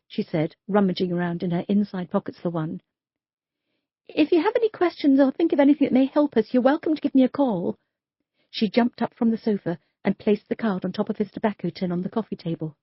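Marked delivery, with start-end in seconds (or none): 4.1-7.8 lightly voiced, higher young detective; polite, a little nervous; Sophia's voice. Detective leaves her card.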